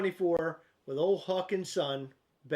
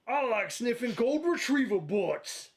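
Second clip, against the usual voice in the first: slow, higher cartoon hound drawl; Huck voice; 5 semitones higher, slower than usual. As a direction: play Huckleberry Hound, eyes shut. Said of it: "say it like Huck would say it".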